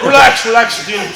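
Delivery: high, loud "settle down" urging; acted loud calming. Coach telling upset fighter to relax, loud and insistent.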